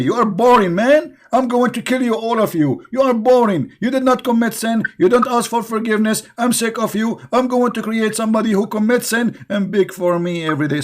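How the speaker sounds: high, scornful divine threat; bored angry God. Retold or imitated. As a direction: sneer like a fed-up tyrant. Voices God as angry tyrant.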